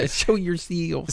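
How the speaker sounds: stretched-out hammed-up Southern twang; bad Southern accent. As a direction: parody a fake Southern movie line, very slowly. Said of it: "never get a good dialect coach".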